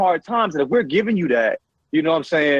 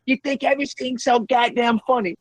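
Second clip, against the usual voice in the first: lightly voiced mom, higher and exasperated; scolding mom; 8 semitones higher than usual. Mom telling off her laughing kid, mock scolding. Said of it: "My mom was yelling at me".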